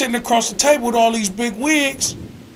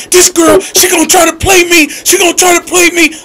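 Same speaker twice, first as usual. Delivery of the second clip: raised, heated voice acting out Robin; retold angry rant; 6 semitones higher, 4 dB louder than usual. Coworker mimicking an angry friend, heated and raised. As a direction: rant about the girl who's trying to play her. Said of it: "She was like".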